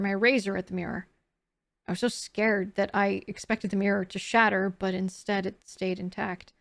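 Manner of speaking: soft, low storytelling read; hushed sleep story narration; bedtime story voice. A narrator's reading. Reads dream journal aloud.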